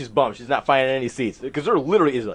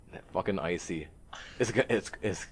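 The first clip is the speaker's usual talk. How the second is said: imitating a hushed, lower aside; retold whisper; 7 semitones lower, 9 dB quieter, faster than usual. Whispering to Christine in the theater, hushed and mock-discreet. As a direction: drop it low and soft, as if whispering. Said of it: "I whisper, I'm like".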